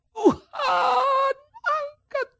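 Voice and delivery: a high, crying cry for help; crying plea. Acted. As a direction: wail it up high in distress. Paul cries out again. (ratapan tinggi sambil menangis)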